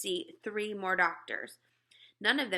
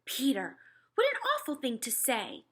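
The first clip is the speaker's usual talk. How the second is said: reproachful mother; 4 semitones higher than usual. Acted. Mother scolds Peter.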